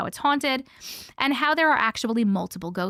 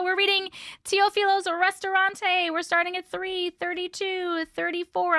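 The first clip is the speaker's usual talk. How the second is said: bad Spanish accent; 8 semitones higher than usual. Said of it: "I have a bad Spanish accent".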